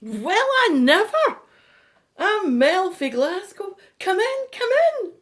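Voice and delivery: high, loud astonished greeting; thrilled, effusive hostess greeting; delighted welcome. Acted. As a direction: usher them in with loud, high-pitched excitement.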